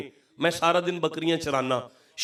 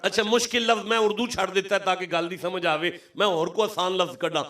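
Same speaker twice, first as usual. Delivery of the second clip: usual speaking voice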